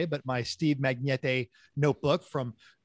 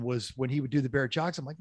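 normal talking voice

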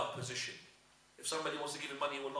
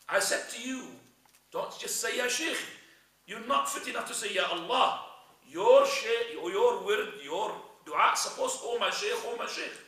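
much higher, louder scolding; telling off; 8 semitones higher, 8 dB louder than usual. Acted. The Sufi Sheikh scolds his drowning follower, loud angry telling-off. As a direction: berate him hard for failing. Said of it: "started insulting him and telling him off".

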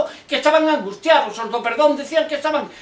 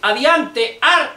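drill command; 5 dB louder, much slower than usual. Acted. Army order sending marchers into the procession, shouted command. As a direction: call the squad forward, punching the last word. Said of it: "E logo de pronto dixeron".

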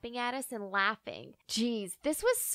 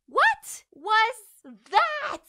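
slow, high, loud disbelief; stunned, dragging the question out; shocked; 9 semitones higher, 7 dB louder, much slower than usual. Acted. Narrator stunned by confession.

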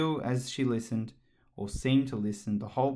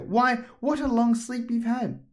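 high, slow sister voice; 12 semitones higher, 6 dB louder, slower than usual. A narrator's reading. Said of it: "said her sister".

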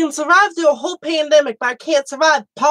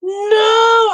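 sharp high gasp, shouted no; 10 semitones higher, 6 dB louder than usual. Real, not acted. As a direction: gasp then cry out no. Shocked at being wrong.

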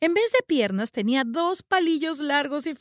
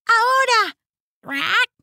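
much higher, loud crow cry; urgent caw; 10 semitones higher, 6 dB louder, slower than usual. Acted. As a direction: call your brother now. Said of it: "graznó mirando a su hermano".

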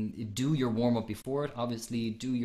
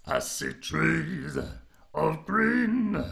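growled singing demo, rising high and loud; 6 semitones higher, 5 dB louder, much slower than usual. Shown as a demonstration. Voice coach showing a student the growl, high growled singing. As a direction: growl it out, drawn out and climbing. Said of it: "So the growl is, again, high larynx".

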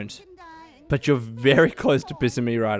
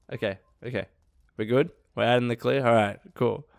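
ordinary talk

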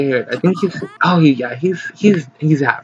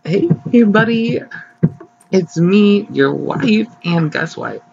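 higher, louder teasing announcement to husband; playful wife voice; 7 semitones higher, 3 dB louder than usual. Pretend. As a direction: coax him like a sweet wife. Voicing wife telling husband.

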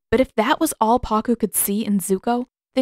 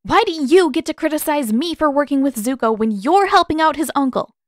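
higher, louder challenge; sharp; 8 semitones higher, 5 dB louder than usual. A narrator's reading. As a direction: press him hard. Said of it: "she said sharply".

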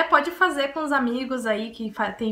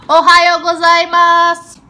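"Ohayou gozaimasu" yelled much louder and higher, stretched slowly; shouted out the window; 6 semitones higher, 15 dB louder, much slower than usual. Real, not acted. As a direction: shout it out the window as loud as you can. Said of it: "Tem que ser bem alto?".

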